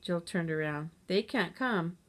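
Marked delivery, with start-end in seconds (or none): none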